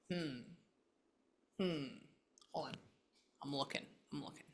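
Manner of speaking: hushed, breathy whisper to a spirit; a quiet medium's murmur; whispered séance. Acted. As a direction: whisper as if sensing someone near.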